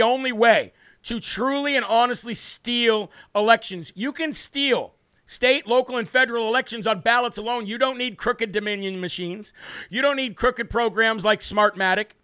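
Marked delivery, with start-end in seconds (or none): none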